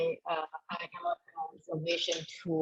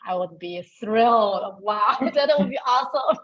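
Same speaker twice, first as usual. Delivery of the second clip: excited; 3 semitones higher, 6 dB louder than usual. Real, not acted. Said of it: "you sound engaged".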